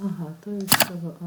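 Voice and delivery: usual speaking voice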